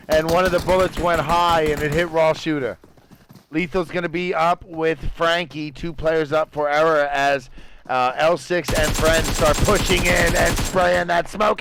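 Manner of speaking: energetic esports caster voice; play-by-play. Acted. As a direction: call the fight live, fast and keen. Live casting of a PUBG match.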